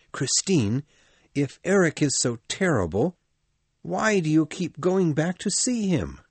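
lightly voiced Raoul asking why; Raoul, puzzled. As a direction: push gently, half worried.

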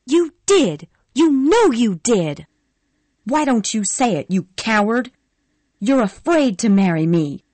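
high, loud furious shouting. Acted. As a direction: scream your accusations at him. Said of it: "Scarlett said furiously".

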